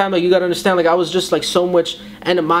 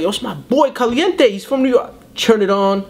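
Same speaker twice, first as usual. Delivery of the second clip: excited; 6 semitones higher than usual. Retold or imitated. Seeing friend on TV.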